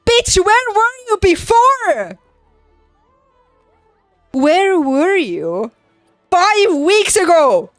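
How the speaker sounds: high, loud, outraged yell; exasperated, full-voiced confrontation; indignant shout. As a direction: hurl it at them, drawing out each word. Indignant retort to the departing employers.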